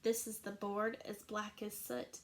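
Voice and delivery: plain reading voice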